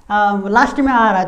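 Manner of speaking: ordinary talk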